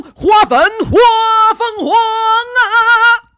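building to a high, slow shout; dramatic build. Shown as a demonstration. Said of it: "放送一下我的绕口令的版本".

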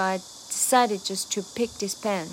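"just to" run together fast, "pick" and "sigh" of "decided" stressed. Shown as a demonstration. Teacher stresses "pick" while explaining the rhythm. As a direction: fire "just to" off quickly, land hard on "pick". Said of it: "just to要连得很快".

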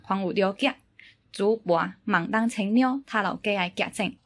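greeting read in Teochew; Teochew dialect. Shown as a demonstration. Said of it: "可以，那我开始了啊".

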